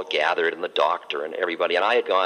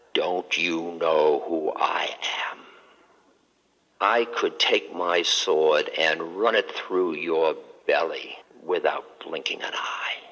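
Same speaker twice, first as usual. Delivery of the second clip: slow, menacing near-whisper threat; menacing captain; 3 semitones lower, slower than usual. Acted. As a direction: threaten him slowly, under your breath. Cruel furious captain threatens monk.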